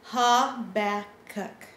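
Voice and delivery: Habakkuk said very slowly, each syllable clear. Shown as a demonstration. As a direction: sound out Habakkuk slowly for kids. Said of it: "will you guys say that with me".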